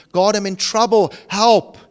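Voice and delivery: acted cry for help; crying out to God. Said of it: "I need to cry out to you".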